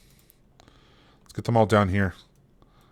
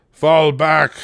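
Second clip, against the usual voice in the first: loud urgent retreat call; shouting orders over battle; 6 semitones higher, 7 dB louder than usual. Real, not acted. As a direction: yell it across the field. Commanding troops in a siege.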